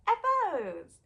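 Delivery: high, loud drawn-out call; segment announcement. Real, not acted. Vlogger announcing the next segment, loud and high. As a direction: announce it with a big, high swoop.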